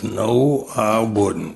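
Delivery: a touch of an Alabama drawl on a short denial; Alabama accent. Retold or imitated. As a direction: lightly imitate the Alabama friend admitting it. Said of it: "I'll do my best shot at Alabama accent".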